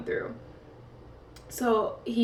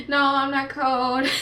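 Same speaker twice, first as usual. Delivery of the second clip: insistent denial; 4 semitones higher, 6 dB louder than usual. Retold or imitated. Insisting to nurses she's not cold.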